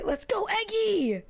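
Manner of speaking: high, slow, drawn-out cheer. Real, not acted. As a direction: cheer the viewer on. Cheering a viewer.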